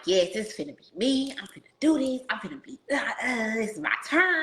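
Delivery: re-enacted excitement climbing in pitch; hyped up. Woman retelling her reaction to watching Stormy, hyped up. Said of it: "I was hyped".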